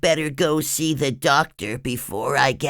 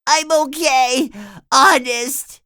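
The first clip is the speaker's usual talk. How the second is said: high, louder insistent plea; pleading; 6 dB louder than usual. Acted. SpongeBob begging Mister Krabs to let him work. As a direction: insist you're fine, begging.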